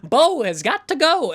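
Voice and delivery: high, loud getaway cry; flustered exit line. Acted. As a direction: call it out while bolting. Fleeing character announces his exit.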